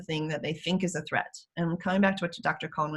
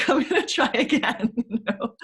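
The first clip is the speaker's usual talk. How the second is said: laughs through the words, a bit higher and louder; laughing self-talk; 6 semitones higher, 5 dB louder than usual. Pretend. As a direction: laugh your way back to hope.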